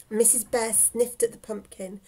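plain reading voice

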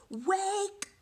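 high, loud cheerful yell. Shown as a demonstration. Teacher shows kids how to yell the robin part. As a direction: call it out like a robin waking everyone. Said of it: "And you gotta yell it".

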